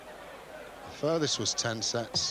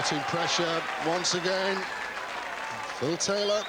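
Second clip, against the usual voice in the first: excited commentary; 6 semitones higher than usual. Real, not acted. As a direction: lift the name with excitement.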